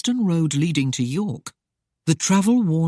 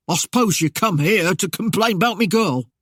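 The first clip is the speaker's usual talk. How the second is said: higher, louder country mayor voice, defensive; rustic mayor; 4 semitones higher, 4 dB louder than usual. A narrator's reading. Mayor fearing his son-in-law's complaint, defensive. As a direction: whine it out like a nervous village mayor.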